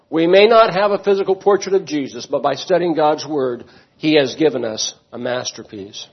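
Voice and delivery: usual speaking voice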